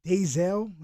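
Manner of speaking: normal talking voice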